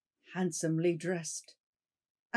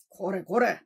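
gruff, louder call; rough exclamation; 5 dB louder than usual. Acted. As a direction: snap at the stranger. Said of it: "roughly exclaimed".

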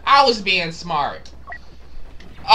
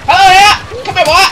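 high, very loud greeting to prey; excited taunting shout; 12 semitones higher, 11 dB louder than usual. Real, not acted. Player pouncing on an enemy, excited shout. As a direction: yell it as you pounce.

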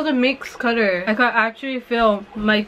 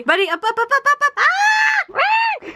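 excited calling. Real, not acted. Calling the puppy up.

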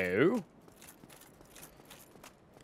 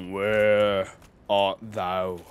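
low mock-archaic voice; 5 semitones lower, much slower than usual. Mock archaic call, voice dropped.

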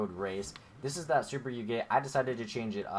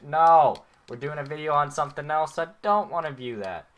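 exasperated high, raised voice; loud protest; 6 semitones higher, 11 dB louder than usual. Real, not acted. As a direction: shout the refusal at the game. Objects to game's viewing prompt.

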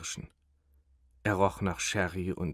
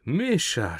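high, louder cry of surprise; astonished; 6 semitones higher, 5 dB louder than usual. Acted. Thin man recognizing his old friend, astonished. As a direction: gasp his name in amazement. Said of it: "staunte der Dünne".

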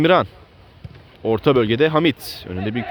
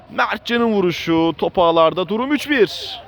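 excited commentator; 7 semitones higher than usual. Real, not acted. Commentator after goal.

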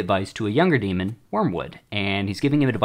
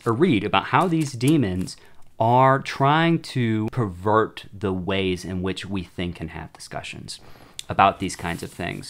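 normal talking voice

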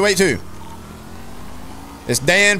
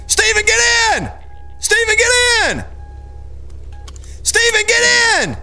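urgent shout; 14 semitones higher than usual. Real, not acted. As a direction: yell for him to hurry. Calling friend into car.

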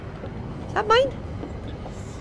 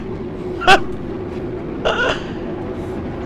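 breathy chuckle; airy laugh; 7 semitones higher, 5 dB quieter than usual. Real, not acted. Laughing at scraping.

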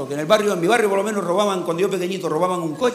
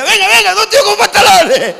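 mocking shout; 12 semitones higher, 10 dB louder than usual. Nudist beachgoer jeers at the three clothed men, loud and high. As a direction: jeer loudly at the clothed guys.

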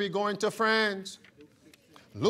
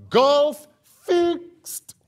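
loud emphasis; 5 semitones higher, 5 dB louder than usual. Real, not acted. Climax of scripture read.

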